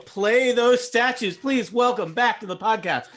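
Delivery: loud, high showman introduction; announcer voice. Acted. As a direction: hype up the guest like an emcee. Hyped guest introduction.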